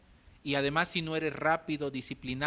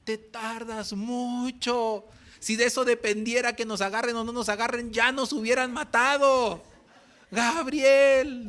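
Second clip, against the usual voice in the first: louder, higher scolding, rising to a yell; an exasperated pastor hurrying him; impatient scolding pastor; 8 semitones higher, 8 dB louder than usual. Retold or imitated. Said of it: "para el pastor era un insulto".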